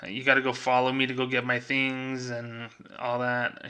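usual speaking voice

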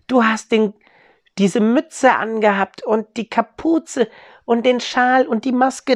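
put-on a child puzzling it out aloud, pitched high; excited child.

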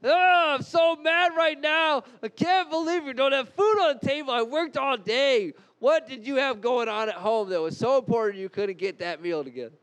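loud, high, furious complaint about dinner; angry husband rant. Acted. Husband storming home, yelling at his wife over dinner. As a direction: rant like a worn-out husband with no meal on the table. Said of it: "So I'm gonna demonstrate".

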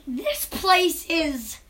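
very slow, stretched words; drawn-out reaction. Retold or imitated. Child recalling first look at the shop, slow drawn-out verdict. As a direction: stretch each word, pausing before the last.

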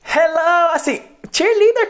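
hello agudo e sorridente; hello de cheerleader. Shown as a demonstration. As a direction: cumprimenta sorrindo como cheerleader. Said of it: "tipo cheerleader". (hello animado de cheerleader)

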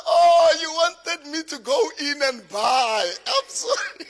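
loud, high mock cry of realization; big exaggerated "oh, now I get it" outburst; sudden realization. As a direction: exclaim it high and loud, hamming up the penny dropping. Acts out his belated realization to wife.

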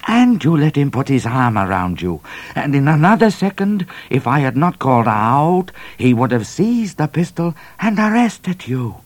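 angry Cat. A narrator's reading. Cat scolding Midnight, high and furious. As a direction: scold him hard. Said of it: "he was so angry".

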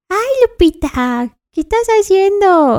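squeaky pet voice asking a question; dog voice. Shown as a demonstration. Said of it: "enséñanos tu voz de perro". (voz de perro aguda y fuerte)

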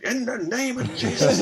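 high-pitched mock Pentecostal invocation; Pentecostal fire-calling. Wife praying over her husband, mock Pentecostal fervour. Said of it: "calling down the fire".

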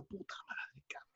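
hushed unvoiced swearing; whispered curse. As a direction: curse under your breath so no one wakes. (maldición susurrada)

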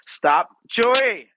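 loud, high scolding by name; sudden real outburst; exasperated shout. Real, not acted. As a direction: yell at the dog to quit. Shouts at dog mid-story.

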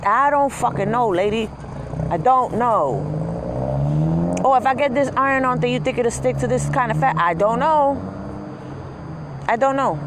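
fed up with the questions; exasperated. Real, not acted. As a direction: throw up your hands. Exasperated refusals.

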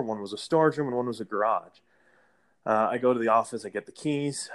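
ordinary talk, nothing marked

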